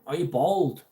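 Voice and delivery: ordinary talk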